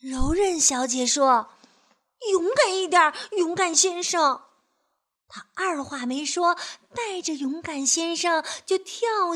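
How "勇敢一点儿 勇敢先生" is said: encouraging. Acted. Encouraging the scared man.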